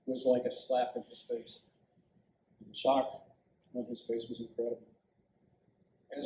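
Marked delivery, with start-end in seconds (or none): none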